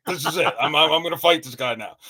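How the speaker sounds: mock fury. Flipping out at friend.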